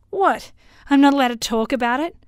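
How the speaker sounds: high, loud, indignant cry; indignant outburst. A narrator's reading. Drunk son challenging his father at the party, loud and indignant. Marcus getting louder at father.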